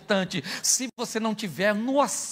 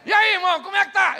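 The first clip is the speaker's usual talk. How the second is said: loud greeting; 10 semitones higher, 5 dB louder than usual. Retold or imitated. Pastor greets man loudly.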